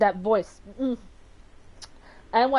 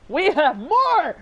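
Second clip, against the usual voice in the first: high, loud, drawn-out cheer; thrilled high yell; excited; 8 semitones higher, 7 dB louder, slower than usual. Real, not acted. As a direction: shout it with glee, stretching the words. Delighted that more audio follows.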